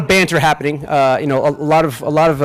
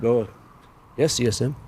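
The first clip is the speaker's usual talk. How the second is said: much softer, a touch lower, deferential; meek reply; 5 semitones lower, 9 dB quieter than usual. Retold or imitated. Acting commander meekly answering the sergeant major, much softer. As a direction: answer meekly like a junior caught out.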